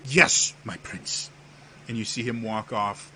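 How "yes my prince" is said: slow, loud, servile reply; obey with drawn-out deference; chastened courtier. Acted. Theus obeys the prince's dismissal, chastened.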